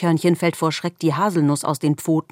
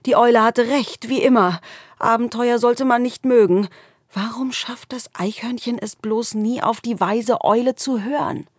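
higher, anxious little animal voice; small scared squirrel; 7 semitones higher than usual. A narrator's reading. Squirrel scolds itself in the dark cave, high and anxious. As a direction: fret like a tiny lost squirrel.

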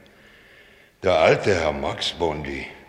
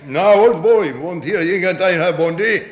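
blustering; 10 semitones higher, 5 dB louder than usual. Acted. Captain bluster at the door.

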